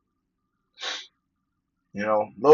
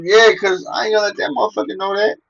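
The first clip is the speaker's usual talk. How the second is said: much higher, louder quoted coworker; coworker's reply; 10 semitones higher, 5 dB louder than usual. Retold or imitated. Coworker explaining why he hides his dislike, high loud voice. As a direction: play the coworker, defiant and pitched up. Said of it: "And he goes".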